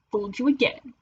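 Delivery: ordinary delivery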